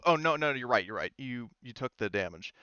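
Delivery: normal talking voice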